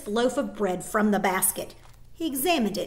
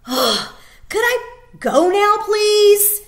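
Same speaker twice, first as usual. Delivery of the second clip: higher and louder, fed-up whine; impatient plea; 12 semitones higher, 12 dB louder, slower than usual. Acted. Girl impatient with the soldier, whining. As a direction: push him to let her go. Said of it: "she asked impatiently".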